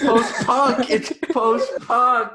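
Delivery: high, laughing through the word; giddy laughing repeat. Real, not acted. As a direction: crack up as you say it.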